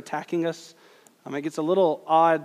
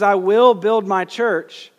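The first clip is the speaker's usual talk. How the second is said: "will" stretched and loud, in a slow, forceful line; stress on will; 4 semitones higher, 8 dB louder, slower than usual. Shown as a demonstration. Preacher quoting Jesus's promise, stress on "will". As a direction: lean hard on "will", slowly and firmly. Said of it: "emphasize the word will".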